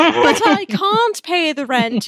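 high, slow, hammed-up damsel; melodrama heroine. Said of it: "if you've ever been to an old-fashioned melodrama".

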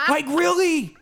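high-pitched, exasperated argument; incredulous rant. Real, not acted. Host arguing with the absent flat-earther, incredulous rant. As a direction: push the pitch right up in disbelief.

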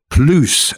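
PLUS said "ploos", with a long oo; military-speak pronunciation. Shown as a demonstration. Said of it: "pronounced that way".

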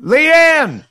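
bright high call across the room. Real, not acted. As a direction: call her name out happily. Calling out to wife on phone.

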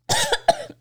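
choking cough; sudden sputtering cough; coughing up water. Real, not acted. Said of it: "[name] choked on her own question".

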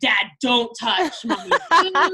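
put-on slow, laughing little-boy warning; a small kid guarding mom, half laughing; lightly voiced bossy toddler; imitating protective son. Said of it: "He was like".

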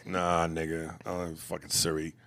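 ordinary delivery

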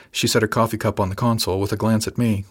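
ordinary delivery, nothing marked

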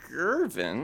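Gervin, drawn out with a condescending rise; patronizing. Acted. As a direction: say Gervin slowly, talking down. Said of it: "Doing it again".

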